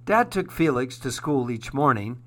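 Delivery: ordinary delivery, nothing marked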